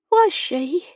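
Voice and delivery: put-on high girlish question; girl's voice pitched well up; surprised young girl. A narrator's reading. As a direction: ask it high, as a hopeful servant girl. Girl Becky surprised to be needed.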